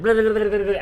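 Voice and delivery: babbled stand-in for a long answer; imitated long reply. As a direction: run of mock babble. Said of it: "this long fucking thing".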